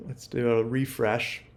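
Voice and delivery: usual speaking voice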